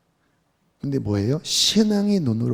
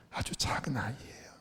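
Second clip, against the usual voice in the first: barely audible whisper, much softer; whispered prayer; 3 semitones lower, 16 dB quieter than usual. Retold or imitated. Brother confesses to the Lord, whispering faintly. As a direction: whisper the words, fading away. Quoting a dying man's prayer.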